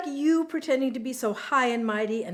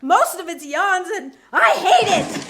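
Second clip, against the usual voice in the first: loud, high furious retort; angry yell, pitched up; enraged shouting; 6 semitones higher, 10 dB louder than usual. Acted. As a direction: shout it out in a rage. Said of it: "you fly into a rage".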